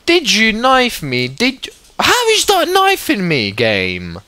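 high, loud outraged complaint; squeaky furious disbelief; indignant yelling. Real, not acted. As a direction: protest at the game that it was unfair. Complaining at the game.

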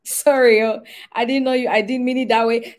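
fleeing demon squealing sorry; pleading spirit. Retold or imitated. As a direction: beg for mercy as a chastened spirit. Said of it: "somebody was saying".

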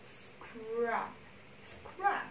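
"Scrub" softly, with a drawn-out s-k-r onset; consonant cluster drill. Shown as a demonstration. Teacher repeating the cluster for the class, slow "Scrub". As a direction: stretch the opening of "Scrub", no vowel between consonants. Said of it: "chỉ bật âm đấy ra thôi".

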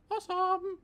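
loud, very high-pitched "awesome"; playful falsetto. Real, not acted. Playful second take of awesome.

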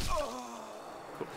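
high, sharp sarcastic "Cool"; mock-cheerful after a sudden death. Real, not acted. Player just killed in game, sarcastic high yelp.

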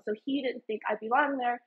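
usual speaking voice

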